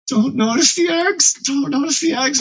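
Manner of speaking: high-pitched nervous plea, said twice; anxious pleading. Acted. Silently willing Frank not to notice.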